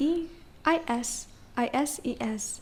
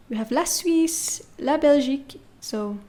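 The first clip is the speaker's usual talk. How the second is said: ordinary delivery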